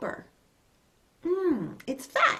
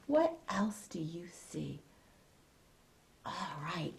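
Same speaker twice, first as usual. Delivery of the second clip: whispering; 5 semitones lower, 10 dB quieter than usual. Real, not acted. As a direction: whisper the question secretly. Repeats question whispered.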